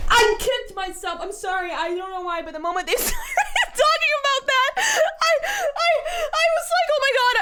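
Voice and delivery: high, squealing laughter through the words; laughing hard. Real, not acted. Streamer explaining she kicked herself, helpless high-pitched laughing. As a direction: barely get it out for laughing.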